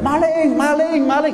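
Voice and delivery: mock villagers shouting thief. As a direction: yell it out like raising the alarm on a thief. Neighbours yelling thief at a suspect.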